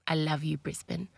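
ordinary talk

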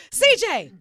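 mock exasperated yell; retold yelling. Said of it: "yelling at C J".

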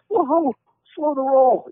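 high, slow drawn-out alarm; mock alarm. Husband jokes about anniversary pressure, high slow alarm. Reacting to the demand.

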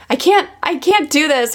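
mildly overwhelmed, imitating a past complaint. Mother complaining to her teacher, mock overwhelmed.

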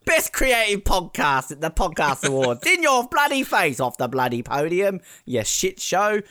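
lightly put-on British voice, taunting the winners; mock British accent. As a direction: pretend British gloat, half-joking. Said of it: "you know, British music".

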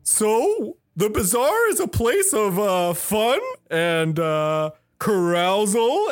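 showman cat host. Acted. Cat host explains the bazaar to visitors, showy and halting. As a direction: sell the place slowly, searching for words.

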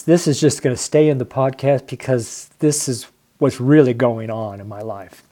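normal talking voice

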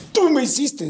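high-pitched mock accusation; lightly voiced resentful outburst; mock angry reproach. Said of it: "ni siquiera había ya el coraje de".

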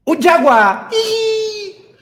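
high, loud, cracking squeal; strangled voice. Pretend. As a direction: voice cracks as it fails. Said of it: "todavía no me sale la voz".

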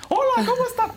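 voz aguda de Mickey Mouse; chillón como ardillita; voz de helio. Retold or imitated. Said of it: "Como que estaba". (voz de helio muy aguda)

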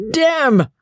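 high, emphatic drawled curse. A narrator's reading. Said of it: "repeated those same British lips emphatically".

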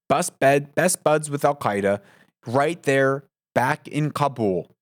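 ordinary talk, nothing marked

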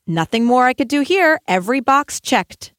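mock contented sigh of a finished day; imagined satisfied thought. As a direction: play the teacher who thinks she's done it all. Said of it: "thought to myself".

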